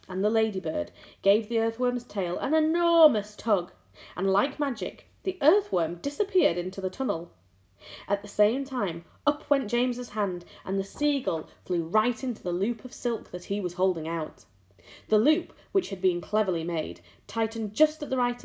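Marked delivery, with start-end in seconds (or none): none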